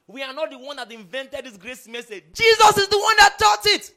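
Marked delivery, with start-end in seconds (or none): 2.3-3.9 much higher and louder, ringing out; emphatic shout. Real, not acted. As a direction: hammer the point home to the crowd. Preacher's emphatic climax.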